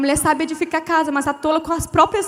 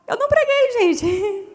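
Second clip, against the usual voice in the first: high squeaky confession; 7 semitones higher, slower than usual. Real, not acted. Confessing with a laugh.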